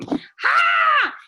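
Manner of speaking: sudden high, loud screaming "Ha!" like a hyped crowd; mock scream. Imitating Mary Kay women screaming at a meeting, high loud whoop. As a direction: burst out with a piercing whoop. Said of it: "they scream".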